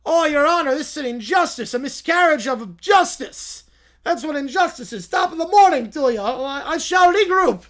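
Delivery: loud, high, hammy bad Irish accent; overplayed fake Irish lawyer accent. To the judge, a lawyer protesting in a bad Irish accent. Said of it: "more Italian Irish".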